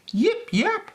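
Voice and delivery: squeaky little puppy bark; high puppy yip. A narrator's reading. Storyteller barks as little Peppy, squeaky high yip. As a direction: yip it out high like a tiny pup. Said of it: "barked Peppy".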